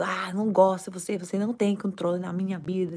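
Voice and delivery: quick half-acted squabble; fast mock quarrel. Mother-in-law quarrel, quick mock spat. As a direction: rattle it off fast, mock argument. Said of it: "se ela e eu tá brigando".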